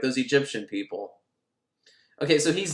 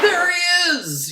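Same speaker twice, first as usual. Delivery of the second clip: high, very slow sing-song reveal; dramatic reveal; 5 dB louder, slower than usual. A narrator's reading. Host revealing the dead friend, high and drawn out. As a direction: stretch it out like a horror sting.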